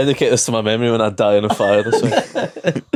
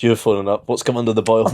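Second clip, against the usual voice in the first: ordinary delivery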